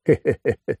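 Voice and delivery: short staccato chuckle. Acted. Victor leaving his beaten rivals, short chuckle. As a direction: chuckle in a quick string of pulses.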